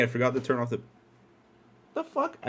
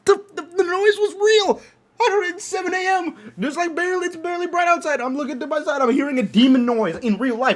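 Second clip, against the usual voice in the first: excited; 5 dB louder than usual. Real, not acted. Retelling the shocking wake-up.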